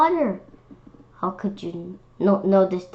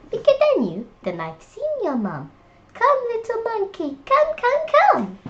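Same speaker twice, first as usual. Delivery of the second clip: higher, beckoning brightly; higher butterfly voice calling come; eager butterfly; 10 semitones higher than usual. Acted. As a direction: call him along cheerfully. Butterfly leads monkey.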